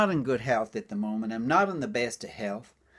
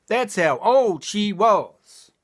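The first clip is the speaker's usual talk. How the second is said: high slow topper; 6 semitones higher, 4 dB louder, much slower than usual. Acted. Punchline topper.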